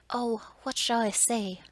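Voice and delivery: loud, drawn-out cry of dismay. A narrator's reading. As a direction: cry it out slowly and loudly. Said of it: "cried Beauty".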